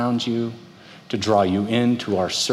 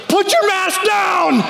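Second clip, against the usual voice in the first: high, slow, shouted order; angry shout; 4 dB louder, slower than usual. Retold or imitated. Referee shouts at a player who lifted his mask, slow and high. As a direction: yell it like a furious paintball referee. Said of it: "And he goes".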